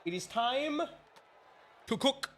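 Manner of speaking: hyped up. Real, not acted. Hyping up the race start.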